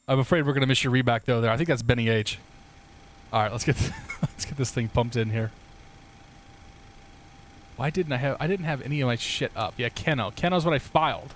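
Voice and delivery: ordinary talk, nothing marked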